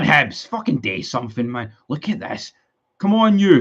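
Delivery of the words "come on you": frustrated yell. Real, not acted. Fan yelling at match on stream.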